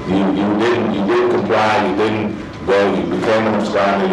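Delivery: ordinary talk, nothing marked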